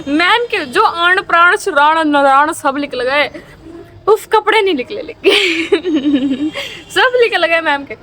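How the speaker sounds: slow, laughing through the words; real laughter telling the punchline. Real, not acted. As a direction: giggle while telling it.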